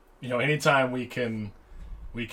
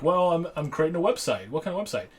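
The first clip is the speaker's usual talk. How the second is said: confident mock quote; 6 semitones higher than usual. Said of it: "He's like, he's like".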